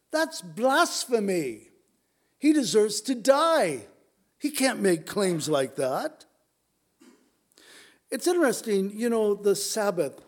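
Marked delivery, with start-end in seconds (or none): 0.1-6.2 outraged, slow, rising to louder and higher on deserves to die; a mock-furious religious leader crying blasphemy; indignant accusation. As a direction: play the scandalised accusers with real heat.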